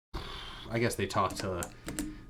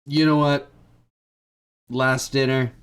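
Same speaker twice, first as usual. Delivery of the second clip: mock hearty give-in; 4 semitones higher, 6 dB louder than usual. Apostles giving in, voiced.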